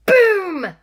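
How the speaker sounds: high, loud sudden BOOM. A narrator's reading. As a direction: burst out to startle the kids. Storm crash at midnight.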